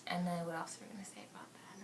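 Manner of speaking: soft unvoiced whisper. Real, not acted. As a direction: whisper the question to her friend. Off-camera question to her friend.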